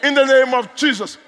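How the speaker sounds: high, loud declarations with stretched key words; full-force commanding prayer. Real, not acted. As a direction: decree it forcefully from the pulpit.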